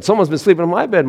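high, quick Mama Bear complaint. Acted. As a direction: chirp it like a put-out mama bear. Mama Bear voiced in story.

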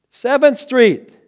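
high, loud, drawn-out call; insistent repeated command. Retold or imitated. Unseen voice redirects the driver who missed the turn, loud insistence. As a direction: call the street name out slowly and forcefully. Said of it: "he hears it again".

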